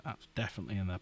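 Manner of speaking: ordinary talk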